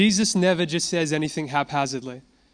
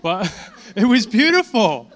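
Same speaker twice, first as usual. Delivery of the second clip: laughing; 8 semitones higher, 4 dB louder than usual. Real, not acted. Laughing after the imitation.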